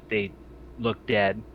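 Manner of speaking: usual speaking voice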